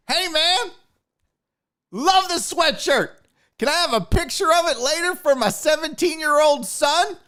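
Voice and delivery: put-on high, overfriendly old man voice, way up in pitch; creepy dad voice. Comic retelling the plane dad, high eager voice. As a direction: play the creepy airplane dad, eager and chummy.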